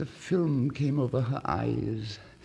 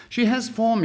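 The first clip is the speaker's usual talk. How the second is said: a lightly voiced student, judging aloud; a touch of a smug young scholar; student musing; 12 semitones higher, 7 dB louder than usual. Student speaks to himself.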